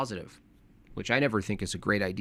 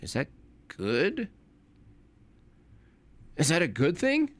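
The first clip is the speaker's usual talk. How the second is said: "good" lifted high in a questioning rise; pointed question with "good thing" rising high at the end; 6 semitones higher than usual. Shown as a demonstration. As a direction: ask it tentatively, pitch climbing on "good". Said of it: "you have to say it like a question".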